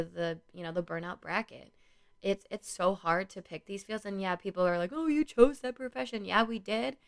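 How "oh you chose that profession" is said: mildly mocking, higher-pitched critic; mock judgmental. People dismissing those in helping fields, mock judgmental. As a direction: imitate a smug critic, pitched up.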